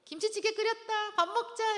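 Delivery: high, loud call across the house; calling to dinner. Acted. Parent calling the family to a stew dinner, loud high call.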